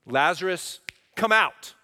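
a ringing command into the grave; commanding call. Retold or imitated. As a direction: call Lazarus out with full authority. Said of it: "he's going".

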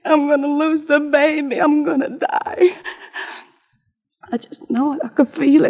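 crying out her fear of dying; a sick woman weeping that she'll die; tearful despair. Acted. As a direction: break down into sobs. Sister fears she'll die.

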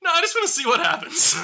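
a touch of a high, offhand scientist; mock Norton. Imitating Norton brushing off his assistant, lightly voiced. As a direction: toss it off lightly as the careless doctor. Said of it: "Norton's just like".

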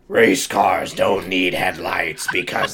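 sinister slow demon voice; demonic voice. Acted. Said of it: "McQueen said in a demonic voice".

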